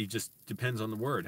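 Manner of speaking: ordinary talk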